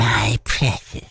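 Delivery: low, slow creature voice; hushed, possessive little creature; Gollum. Acted.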